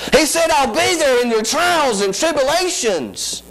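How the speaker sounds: high, rising preacher's whoop; preaching at full force. Acted. Preacher whooping to the congregation about her struggle. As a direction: lift it into a sung sermon cry.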